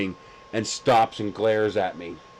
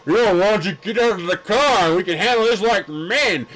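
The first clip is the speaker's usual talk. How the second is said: puffed-up tough-guy challenge, a little higher; mock tough guy; 6 semitones higher than usual. Mocking the pedestrian's macho challenge as a swaggering tough guy. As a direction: ham up a swaggering street tough. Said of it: "He was expecting to be all".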